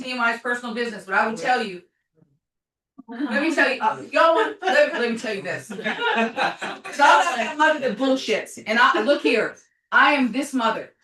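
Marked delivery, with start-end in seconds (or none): 3.1-8.6 laughing emphatic. Real, not acted. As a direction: laugh while insisting hard. Laughing, heated declaration.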